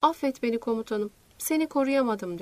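ordinary delivery, nothing marked

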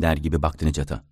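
usual speaking voice

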